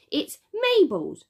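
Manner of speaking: put-on toddler shouting, high and slow; loud little girl's yell; top of her lungs. A narrator's reading. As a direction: yell it out like a small child. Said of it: "she said at the top of her lungs".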